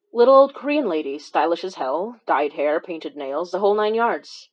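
ordinary delivery

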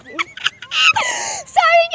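high squeaky giggling apology; sorry through the giggles; giggly. Real, not acted. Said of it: "I've just got the giggles".

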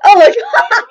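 high, loud laughing oh my god; sudden shocked realization with a laugh; surprised. Real, not acted.

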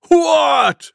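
loud, high falling bellow; shocked buffalo bellow. A narrator's reading. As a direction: bellow it in disbelief. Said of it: "bellowed Wild Buffalo".

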